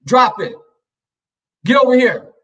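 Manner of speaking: high, punchy order; bad cop command. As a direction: call the pet to heel sharply. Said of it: "I had to be the bad cop".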